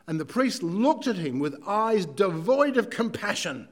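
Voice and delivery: usual speaking voice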